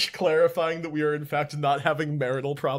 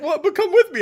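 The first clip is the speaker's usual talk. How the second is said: high, louder pleading cat voice; voicing the cat; 13 semitones higher, 8 dB louder than usual. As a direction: beg like a puzzled cat. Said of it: "He's like".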